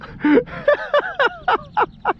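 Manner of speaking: high rapid cackle; laughing hard. Real, not acted. Angler still amused by buddy's mishap, high laughing fit. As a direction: break into high, helpless laughter.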